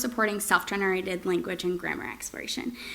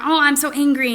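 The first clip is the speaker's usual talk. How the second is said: higher, louder mock anger; angry; 6 semitones higher, 6 dB louder than usual. Shown as a demonstration. Therapist showing a parent a strong angry tone. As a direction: ham up the anger for the child. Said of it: "you use that intonation or sing-songy".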